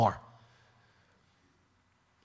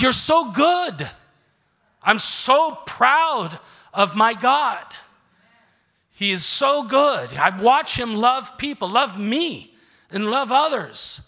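slow, higher, louder praise of God; awed praise; 7 semitones higher, 5 dB louder, slower than usual. Retold or imitated. Praising God after hearing a testimony, slow awed exclamation. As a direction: marvel at each word. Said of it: "I'm like".

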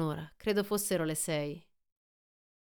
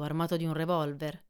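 usual speaking voice

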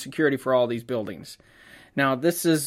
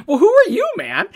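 pitched way up, loud, tossing the question back; mock echo; 6 dB louder than usual. Photographer mocking the guard's question back at him, high and loud.

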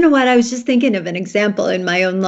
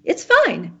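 higher-pitched, easygoing reassurance; harmless fine; 8 semitones higher than usual. Retold or imitated. Wife shows her husband what she meant, harmless fine. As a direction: say it lightly, meaning no offense. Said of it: "it just meant the word".

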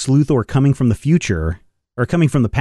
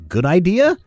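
pitched up high, hopeful rising question; bright hopeful kid fan, ending on a rise; eager fan asking; 8 semitones higher than usual. Retold or imitated. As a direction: sell it like a kid pitching his idea. Fan's letter ends in question.

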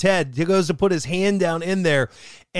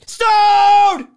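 long, high, very loud bellow; drawn-out mock-rowdy yell; playful roar; 11 dB louder than usual. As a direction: holler it out like a party chant. Playful roar of beer name.

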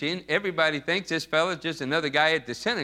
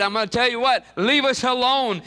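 higher-pitched, heated mock demand; acted defiance; 7 semitones higher, 3 dB louder than usual. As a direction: tell the preacher off as the possessed man. Acts the devil's defiant demand.

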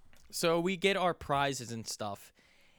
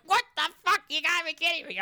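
high, loud exasperated yell; mock-furious what the fuck at the machine; agitated outburst; 7 dB louder, much faster than usual. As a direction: yell it like the claw just cheated you. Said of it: "I'm getting more and more agitated".

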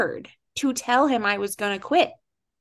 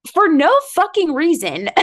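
exasperated outburst; 8 semitones higher, 6 dB louder than usual. Real, not acted. Venting about pointless crying.

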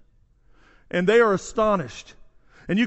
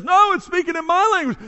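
much higher, insistent objection; excited contradiction; 10 semitones higher, 6 dB louder than usual. Acted. Man from Mesopotamia corrects the others, excitedly insistent. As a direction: butt in with a high-pitched correction. Third bystander joins the acted dispute.

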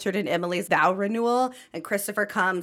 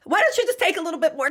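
desperate backpedal; 12 semitones higher, 5 dB louder, much faster than usual. Retold or imitated. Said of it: "I'd be like".

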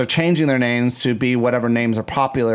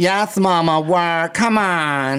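camp, higher-pitched cheer; 5 semitones higher, much slower than usual. Acted. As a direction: play it up high and sassy. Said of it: "Like that?".

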